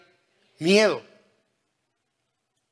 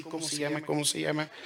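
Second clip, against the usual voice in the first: muttering to himself; 3 semitones lower, 10 dB quieter, faster than usual. Retold or imitated. Pastor muttering, forgetting a name.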